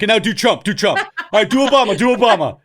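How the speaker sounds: high, loud, hammed-up requests; eager demands. Imagined examiner demands impressions of presidents, mock-eager and loud. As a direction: egg them on like an overeager examiner.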